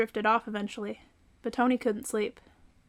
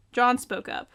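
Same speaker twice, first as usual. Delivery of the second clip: ordinary delivery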